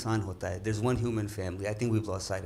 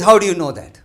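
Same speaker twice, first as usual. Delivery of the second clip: challenging shout; 6 semitones higher, 14 dB louder than usual. Retold or imitated. Guy challenges the father loudly.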